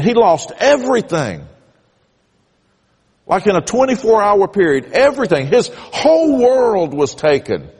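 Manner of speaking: rising emphatic preaching. Real, not acted. Preacher building emotional emphasis.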